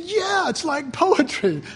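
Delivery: voicing Fred. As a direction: echo her like an amused husband, drawn out. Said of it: "And he said".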